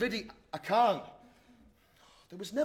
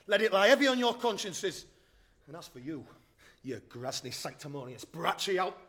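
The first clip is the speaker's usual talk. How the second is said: ordinary talk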